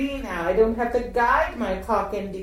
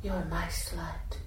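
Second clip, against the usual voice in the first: whisper; 3 semitones lower, 12 dB quieter than usual. Acted. Intimate erotic roleplay.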